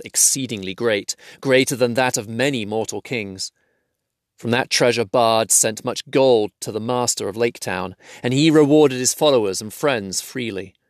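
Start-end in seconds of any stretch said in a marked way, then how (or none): none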